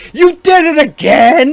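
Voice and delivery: high, slow, loud scold; scolding girlfriend. Retold or imitated. Girlfriend catching him leaving the car running, loud scolding. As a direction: pounce on him with the accusation. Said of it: "jumped on him and was like".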